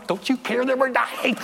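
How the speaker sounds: high-pitched, hammed-up wail; playfully overdone cry to wake a sleeper; mock panicked cry. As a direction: wail it in comic desperation. Mimics waking wife in storm.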